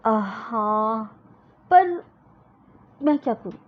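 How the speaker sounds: put-on slow, hesitant child's whine; a hurt little girl dragging out her words; lightly voiced sulky girl. Parul answering her elder sister, sulking.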